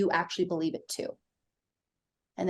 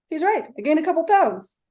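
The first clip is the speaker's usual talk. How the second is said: high and breezy, a little louder; laughing it off; 8 semitones higher, 5 dB louder than usual. Retold or imitated. Daughter retelling her reply to dad, laughing it off. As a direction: brush it off lightly. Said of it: "Laughed it off".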